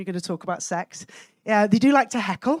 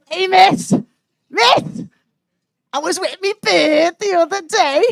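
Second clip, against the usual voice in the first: higher, loud Liverpool schoolboy; cocky year-nine boy; 5 semitones higher, 5 dB louder, slower than usual. Acted. Boy telling teacher about his weekend, cocky call. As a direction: brag to get miss's attention. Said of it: "the cock of year nine".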